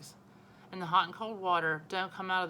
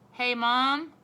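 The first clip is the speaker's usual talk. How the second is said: loud call across the house to Mom; 6 semitones higher, 9 dB louder than usual. A narrator's reading. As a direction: call Mom from another room, loud and high. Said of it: "I called".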